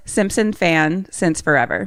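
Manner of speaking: measured, slowed-down name readout. Shown as a demonstration. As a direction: say each word slowly and evenly. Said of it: "Say, say it slower".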